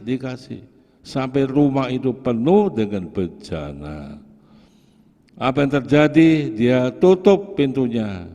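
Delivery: loud, driving sermon voice with rising peaks; fervent preaching. Acted. Pastor urging his congregation, preaching loudly at full force.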